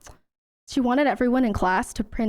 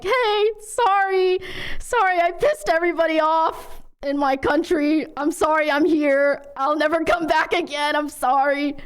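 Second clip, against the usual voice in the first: higher, hammed-up nervous apologizing; mock apology; 5 semitones higher than usual. Streamer imagining meeting people back home, over-apologizing in mock panic. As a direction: play a sheepish visitor over-apologizing.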